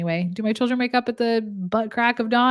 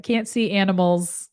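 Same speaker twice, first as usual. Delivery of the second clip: ordinary talk, nothing marked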